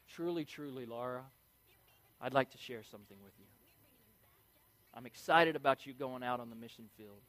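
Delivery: low, soft solemn biblical address; mock biblical speech. Shown as a demonstration. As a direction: intone it like an old scripture. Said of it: "start talking like this".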